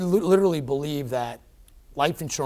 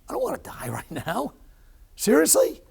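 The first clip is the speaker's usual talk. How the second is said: laughing then a high incredulous seriously; mock outrage at dying now; disbelief; 10 semitones higher than usual. Patient on the table reacting to his heart stopping, incredulous. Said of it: "I kinda got angry".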